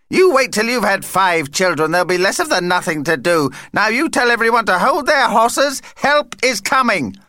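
high-pitched, emphatic insistence; bossy excitable vampire. Acted. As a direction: take charge in a high character voice. Vampire Vlad insists on helping.

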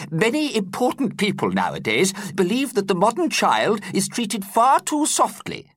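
put-on high, fretful old teacher voice; worried teacher explaining. A narrator's reading. Teacher explaining to pupils in his high worried voice. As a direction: explain it with a nervous quaver in mind.

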